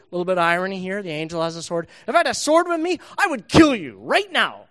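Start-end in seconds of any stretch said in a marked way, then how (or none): 2.1-4.7 much higher, louder threat; furious Balaam. Acted. Balaam threatening his donkey, higher and louder. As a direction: threaten it at full pitch. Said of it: "Balaam's very concerned about how he looks".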